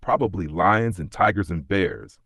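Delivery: ordinary delivery, nothing marked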